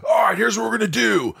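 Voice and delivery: hammed-up commanding yell; mock take-charge shout. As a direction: bark it like a bossy director.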